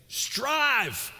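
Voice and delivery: loud, high urging, slowing on "lean into this"; Paul's call, pushed hard and high; preaching at full force. Acted. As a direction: drive it home like a fired-up preacher.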